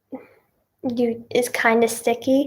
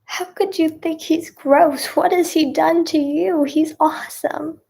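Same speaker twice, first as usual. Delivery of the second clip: mock indignation; 4 semitones higher than usual. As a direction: retell your shocked defence of someone you admire.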